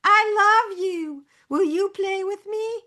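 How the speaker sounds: very high, slow doll voice; sweet toy-doll voice saying its line; talking doll voice. Retold or imitated. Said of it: "Catty Cathy".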